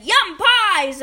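loud, high eager cheer; delighted exclamation. A narrator's reading. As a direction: pounce on the treat with glee. Little brother delighted by pies.

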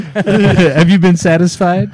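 slow, high, hammed-up come-on; drawn out like a sultry streaming service; mock seductive Netflix voice. Voicing Netflix, slow, far.

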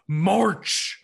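mock gravelly drill-order shout; loud imitated command with gravel in it; gravelly bark. Imitating a dad's marching order, gravelly bark. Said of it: "gotta put that gravel in the voice".

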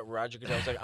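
ordinary talk, nothing marked

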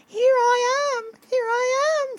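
very high, loud repeated call; shouting. A narrator's reading. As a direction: cry out high so searchers can hear. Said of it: "Franklin shouted over and over".

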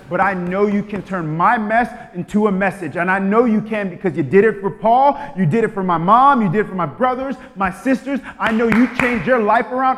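loud, fervent declaration; bold prayer. Shown as a demonstration. Believer in hard season declaring to God, loud. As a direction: shout your faith through the storm. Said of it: "that you're like".

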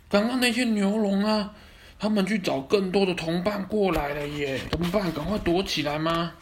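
high, anxious little flyer; high worried voice. Acted. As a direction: squeak out the warning. Flying friend warns of danger.

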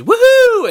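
high, very loud whoop; screamed woohoo. Retold or imitated. Boy leaping off his bed, cartoonish screamed whoop. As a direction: let out a cartoonish joyful scream. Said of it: "like, screams".

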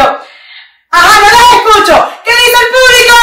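voz de animador, más alta y fuerte; imitando a un animador. Retold or imitated. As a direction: arengar al público como presentador. (imitando a un presentador que anima al público, más alto y fuerte)

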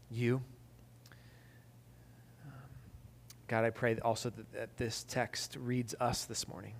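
low, hushed pastoral prayer. Real, not acted. Pastor praying before the sermon, low and soft. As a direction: pray softly and unhurriedly to God.

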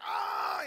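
loud mock crying wail; imitated child's wail. Dad imitates his fallen son crying, loud mock wail. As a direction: wail "ah" like a hurt little boy. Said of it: "he's crying".

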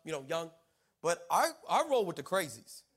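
ordinary delivery

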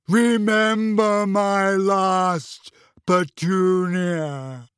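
slow, loud, drawn-out intoning; awful booming voice. A narrator's reading. Burning letter speaking to the aunt, awful booming voice. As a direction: boom out each word like a dreadful message. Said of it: "An awful voice filled the kitchen, echoing".

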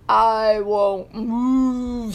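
slow, mooing refusal; drawn-out stubborn cow voice; bossy cow. A narrator's reading. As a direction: refuse with a long moo. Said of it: "said Bossy Cow".

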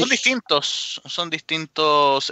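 "Son distintos" slow, high on "Son"; insistent emphasis. Real, not acted. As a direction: insist firmly, word by word. Expert insisting on difference.

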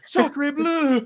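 high squeaky French exclamation; French droid voice. Retold or imitated. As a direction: cry it out like a startled French droid. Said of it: "that guy speaks French".

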